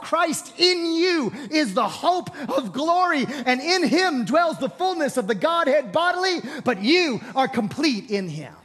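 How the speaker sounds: loud, high preaching shout; full-force preaching. Acted.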